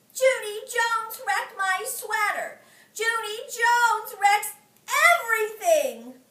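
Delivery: high, loud slow accusing. Acted. May blaming Junie to the teacher, high and loud. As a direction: drag out the blame.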